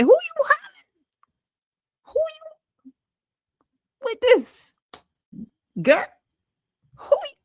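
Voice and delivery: shocked squeal of disbelief; incredulous outburst. Real, not acted. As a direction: cry out who are you yelling at. Reacting to Tyra yelling, mock outrage.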